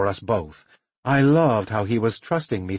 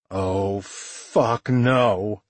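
ordinary delivery, nothing marked

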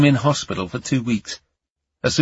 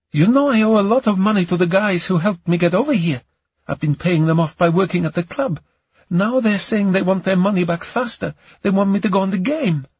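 high, fast woman's voice; distressed woman caller; 8 semitones higher, faster than usual. Acted. Camellia tells Ion about her debts, high and pressed. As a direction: plead your troubles in a raised woman's voice. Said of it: "She sounded like she was on the verge of tears".

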